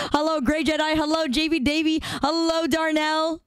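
high, chirpy hellos to each name; bright streamer greetings. Acted. Streamer greeting chat names one by one, high and chirpy.

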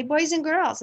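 teacher addressing class. Acted. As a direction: call the class to attention like a teacher. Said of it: "So you can say".

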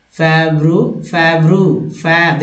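"फेब्रु-" drawn out as feb-roo; model pronunciation. Shown as a demonstration. Teacher starting the second word, model pronunciation. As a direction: hold both syllables of "फेब्रु-". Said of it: "जबकि दूसरा को".

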